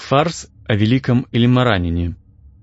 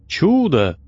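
fake awe; 7 semitones higher than usual. A narrator's reading. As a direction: raise Чудо in mock wonder. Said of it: "восклицая с фальшивым благоговением".

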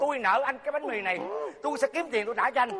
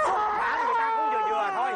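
mock outrage; 9 semitones higher than usual. Outraged outburst in skit.

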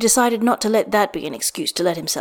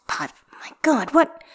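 pained groan; 6 semitones higher than usual. Acted. Waking with aching muscles.

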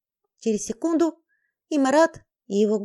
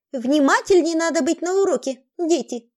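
mocking; 5 semitones higher, 5 dB louder than usual. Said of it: "глумливо кривлялась".

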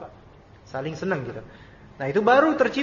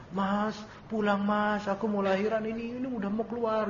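imitated pleading wife; 3 semitones higher, 6 dB quieter than usual. Wife in labour phoning her husband, mock pleading. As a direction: mimic a wife begging her husband home, dragging the words. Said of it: "istrinya nelpon".

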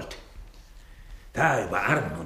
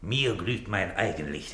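usual speaking voice

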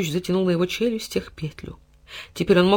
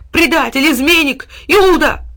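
high, loud barking accusations; furious dog yell; 11 semitones higher, 9 dB louder, slower than usual. A narrator's reading. Dog barking insults at the hiding cat. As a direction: spit each name at him louder.

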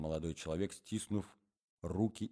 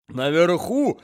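put-on higher, louder old man squeezing the word out; old man's voice; 11 semitones higher, 15 dB louder, much slower than usual. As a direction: croak it out between coughs. Old man between coughs.

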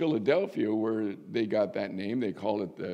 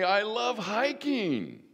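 much higher, slow put-on voice for I love hiking; playful congregant voice; 11 semitones higher, slower than usual. Voicing hikers in the congregation, high and playful. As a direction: pipe it up high like an eager member. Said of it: "Some of you can say".

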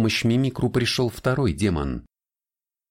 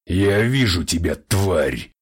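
louder, distorted demon snarl; demonic voice; 3 dB louder, slower than usual. Acted. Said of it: "разнёсся по парадной демонический голос Фабиана".

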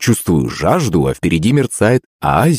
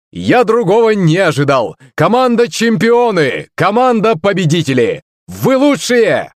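excited host's cry; 12 semitones higher than usual. A narrator's reading. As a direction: hype both teams to the hall. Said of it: "закричал ведущий".

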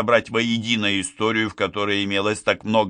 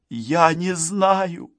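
tearful; 7 semitones higher, much slower than usual. A narrator's reading. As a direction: stretch it out as if near tears. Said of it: "всхлипнула Гарриет". (жалобно, почти всхлипывая)

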